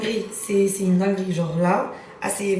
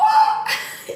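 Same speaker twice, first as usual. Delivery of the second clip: burst of laughter; 3 dB louder than usual. Real, not acted. Cousin's panic retold.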